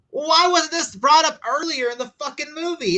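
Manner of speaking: high-pitched, incredulous complaint; exasperated rant. Retold or imitated. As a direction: demand an explanation in high-pitched disbelief. Venting his reaction to a plot twist.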